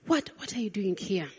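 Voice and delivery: soft, unvoiced fatherly question; tender whispered question; hushed whisper. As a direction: whisper it gently, like a father leaning in. God asks tenderly, hushed.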